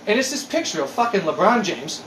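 normal talking voice

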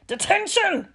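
high, furious shout. A narrator's reading. Teacher catches pupil out at night, shouting. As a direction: shout it as an outraged punishment. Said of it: "she shouted".

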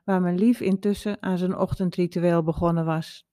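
plain reading voice

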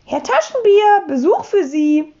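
high, loud landlady's call. A narrator's reading. Frau Rotkohl announces a visitor, calling out. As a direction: call him from down the hall. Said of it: "da rief schon Frau Rotkohl".

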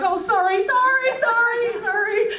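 rising squeaky sorry, sorry; high-pitched flustered apology; frantic high apologies. Retold or imitated. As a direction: apologise over and over in a high panicky voice. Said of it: "you're like".

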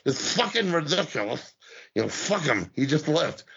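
ordinary talk

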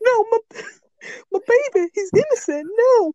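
high whiny plea, laughing through it; high mock-desperate father; Malik pleading. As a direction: squeak out a desperate parent's plea. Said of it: "Malik's like".

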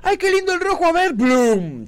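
put-on a toddler squealing at a shiny button; childish delight. Co-host plays the president pressing the red button, high and babyish. Joining the childish Biden bit.

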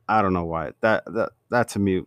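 ordinary talk, nothing marked

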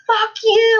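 loud, high angry driver's yell; road rage yell. Retold or imitated. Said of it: "sometimes they have road rage".

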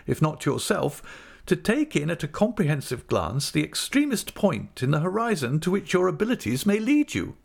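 high-pitched, emphatic wifely lecture; Mrs Micawber, reproving. A narrator's reading.

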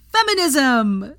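high, loud, drawn-out cheer; excited cry. A narrator's reading. Veronica cheering the letter with a loud cry. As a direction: throw the word up like a victory cry. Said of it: "cried Veronica".

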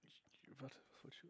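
muttered whisper; whispering to self. Real, not acted. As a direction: whisper it to yourself. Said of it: "ich hab vergessen".

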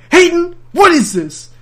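high, loud mock interrogation; mock accusing mom. Mom confronting the little brother, mock accusing and loud. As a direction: bark it like a suspicious mom.

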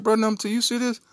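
begging his brother for help; panicked plea. Retold or imitated. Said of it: "So I look up like".

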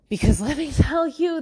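laughing high burst. Real, not acted. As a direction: laugh it out, almost squealing. Laughing aside about own prayers.

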